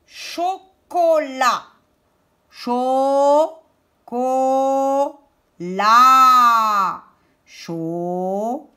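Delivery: CHOCOLAT split into long CHO, CO, LA; syllable by syllable. Shown as a demonstration. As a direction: sound out CHOCOLAT with pauses between syllables. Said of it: "ou troisième syllabe".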